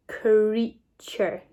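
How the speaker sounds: CREATURE stretched into crea, ture; slow blend. Shown as a demonstration. Teacher blending the sounds for pupils, CREATURE drawn out in parts. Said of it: "let's sound it out together".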